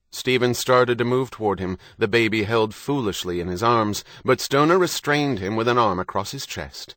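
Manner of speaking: ordinary delivery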